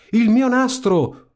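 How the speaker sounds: high, slow jealous shriek; a stepsister screeching over stolen pearls; envious high screech. A narrator's reading. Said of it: "urlò un'altra".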